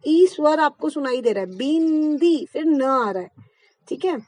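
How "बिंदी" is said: बिंदी said with a clear ई before the n; बिंदी, vowel first, n after; vowel then nasal. Shown as a demonstration. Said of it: "तो पहले मैंने बोला बी, ई".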